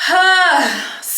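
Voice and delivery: loud, high exhale; forceful heated sigh; exasperated huff. Real, not acted. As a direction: blow out the frustration in one big huff. Venting how heated they are.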